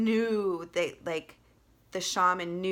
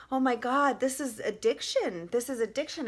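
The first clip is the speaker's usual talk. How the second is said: higher, louder mock alarm, realizing; a touch of horrified realization; retold alarm; 6 semitones higher, 3 dB louder than usual. Retelling the hell vision to viewers, a touch of horrified alarm.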